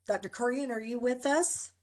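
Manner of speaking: ordinary talk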